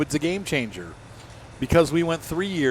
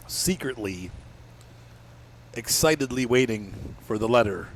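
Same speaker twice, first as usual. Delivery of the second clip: ordinary delivery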